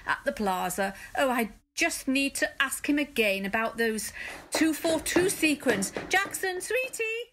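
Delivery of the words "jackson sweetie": high sing-song call. Acted. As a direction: call across the room sweetly. Calling out to director.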